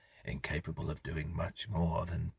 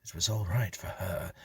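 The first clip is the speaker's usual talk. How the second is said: ordinary delivery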